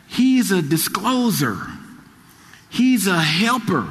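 each title slow and weighty; stately preaching list; slow emphatic litany. Acted. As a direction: savour every name of the Spirit.

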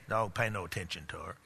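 ordinary talk

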